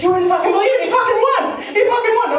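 high, loud, thrilled shout; excited mom. Retold or imitated. Mom calling with wild news, high excited shout. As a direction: yell it like mom can't believe it.

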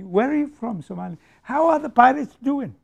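high-pitched retold greeting; nosy stranger mimicked. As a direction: lightly mimic a curious foreigner's questions. Mimicking people greeting him abroad.

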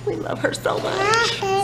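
high, slow, cooing adoration; gushing. Real, not acted. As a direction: melt over the little one. Gushing over daughter.

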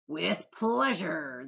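slow, delighted "With pleasure"; grinning. A narrator's reading. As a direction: relish the request with a grin. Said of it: "answered, grinning".

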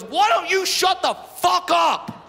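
high, loud, drawn-out shout; furious driver shouting someone down; angry yell. Acted. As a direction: yell it slowly, hitting every word. Rude driver yelling at mom.

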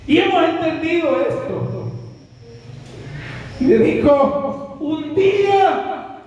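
father's answer turned into a fervent sermon; preaching climax. Acted. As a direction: proclaim God's will as a sermon peak. Father's words preached at full force.

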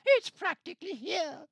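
high, loud Grinch yelp; Grinch outburst. A narrator's reading. Grinch grumbling that Christmas is here, high loud yelp. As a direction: squeal it out in disgust.

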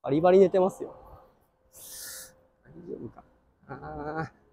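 hushed whisper beside a sleeper. Real, not acted. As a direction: keep it low, someone is asleep.

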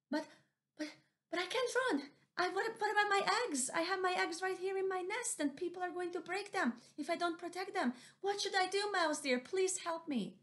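very high, stammering panic; frantic Missus Owl. Acted. Missus Owl begging mouse deer to save her eggs. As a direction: plead for help, voice climbing.

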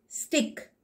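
usual speaking voice

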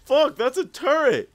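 screeched gamer curse at a surprise turret; retold panicked yell. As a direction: shout it like you just spotted danger. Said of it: "I was like".

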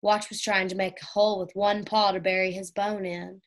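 ordinary delivery

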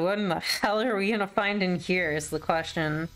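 ordinary talk, nothing marked

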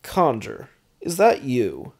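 a cocky vampire lord, amused; half-voiced smug baron hailing an old foe; a hint of an irreverent vampire baron. A narrator's reading. McKinn greets Conjur.

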